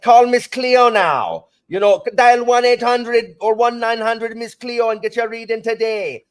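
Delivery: high, loud TV-psychic pitch in fake Jamaican accent; Miss Cleo, fake Jamaican accent. Teacher imitating a TV psychic's hotline pitch, fake Jamaican accent. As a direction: belt out a hammed-up Jamaican accent, drawn out and high. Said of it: "she had this fake Jamaican accent".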